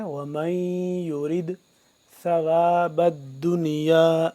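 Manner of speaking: slow, drawn-out tajweed chanting; measured Quranic recitation. Shown as a demonstration. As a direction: recite each word with long held vowels. Recites verse slowly.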